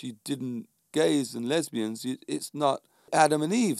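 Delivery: normal talking voice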